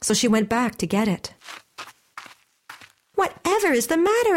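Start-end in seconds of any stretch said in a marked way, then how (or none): 3.1-4.3 high-pitched, astonished mother; startled concern at normal volume; surprised question. A narrator's reading. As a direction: ask in surprise, pitch leaping up. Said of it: "asked her mother in surprise".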